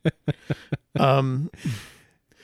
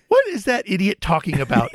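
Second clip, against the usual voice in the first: higher, louder hammed-up disbelief; mock indignation; 8 semitones higher, 5 dB louder than usual. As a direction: play a scornful wife dismissing her husband. Acted wife scoffing at husband.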